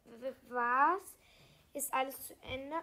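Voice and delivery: loud stuttered question, rising sharply; shocked stammer. A narrator's reading. Child hears the bangs and stammers in shock. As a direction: stumble into a loud, startled question.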